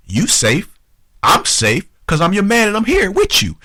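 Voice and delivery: mock macho insistence, rising; insistent protective boast. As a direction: build into an indignant, high-pitched reassurance. Acting the man insisting he protects her.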